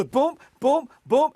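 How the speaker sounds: loud, high, punched booms; mounting mock frustration on each thump; imitated scanning thumps. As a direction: hammer out each failed scan. Imitates scanning again, building.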